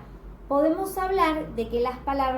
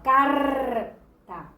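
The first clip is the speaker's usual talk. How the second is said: "carta" with loud, long "car", detached "ta"; stress on first syllable; 5 dB louder than usual. Shown as a demonstration. Teacher showing how the syllable sounds, exaggerated stress on "car". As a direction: hammer and hold "car" in "carta". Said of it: "cómo suena esa sílaba".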